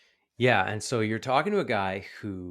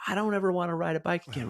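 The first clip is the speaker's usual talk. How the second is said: put-on high, quick kid's protest; mock tearful child, pitched up; imitated crying child; 9 semitones higher, faster than usual. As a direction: mimic an upset little boy. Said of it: "And he's crying".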